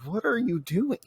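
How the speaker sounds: high, soft incredulous question; mock bewilderment. Imagined reaction to friend's hand.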